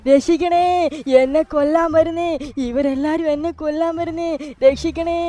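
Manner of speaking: high, loud frantic pleading; terrified car begging to be saved; panicked cry for help. Acted. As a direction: scream for help as if chased by killers.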